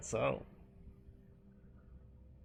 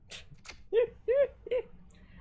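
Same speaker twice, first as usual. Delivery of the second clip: high shrieking laugh; 7 dB louder than usual. Real, not acted. Reacting to own offensive joke.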